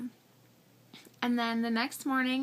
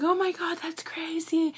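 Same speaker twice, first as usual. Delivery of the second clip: a hint of a thrilled friend, higher; excited friend; 6 semitones higher than usual. Best friend Amanda in the car, mock thrilled. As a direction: play the amazed best friend. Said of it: "she's like".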